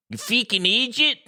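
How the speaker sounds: high-pitched Irish insult; mock Irish accent. Shown as a demonstration. Testing co-host on the accent, mock Irish. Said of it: "tell me which accent I'm doing".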